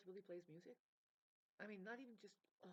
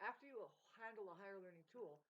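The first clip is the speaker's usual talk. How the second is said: usual speaking voice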